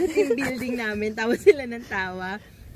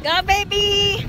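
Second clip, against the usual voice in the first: high, slow, drawn-out cheer; excited cheer; 8 semitones higher, 6 dB louder, much slower than usual. Real, not acted. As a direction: cheer them on loudly. Cheering another jump.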